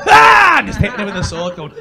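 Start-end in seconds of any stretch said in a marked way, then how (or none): 0.1-0.6 sudden high shrieking yell; bob-bag warrior's battle cry. Retold or imitated. YouTube guy attacking his punch bag with a high yell. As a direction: let out a wild hammed-up war cry. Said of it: "and he's just going".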